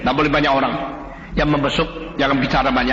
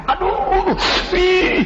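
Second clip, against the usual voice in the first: pained yelp; 13 semitones higher, much slower than usual. Acted. Head nurse in pain.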